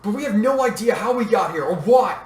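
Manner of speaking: projected, insistent call; shouting over noise. A narrator's reading. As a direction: make yourself heard over the din. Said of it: "shouted to be heard above the noise".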